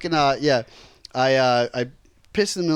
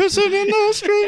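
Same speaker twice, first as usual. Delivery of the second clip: high silly voice. Pretend. High singsong repeat.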